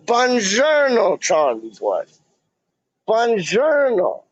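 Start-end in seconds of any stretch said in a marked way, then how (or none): 0.1-1.2 high, slow, drawn-out sing-song Italian buongiorno; mock Italian greeting. 3.1-4.2 high, slow, drawn-out sing-song Italian buongiorno; mock Italian greeting.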